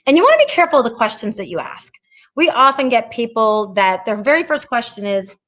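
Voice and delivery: ordinary talk, nothing marked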